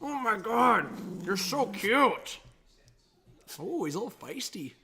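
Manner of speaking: doting puppy talk; cooing at a puppy. Real, not acted. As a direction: gush over the cute dog. Cooing at the dog.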